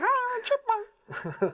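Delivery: very high, slow squeaky chipmunk. Shown as a demonstration. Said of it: "I can't do a chipmunk voice".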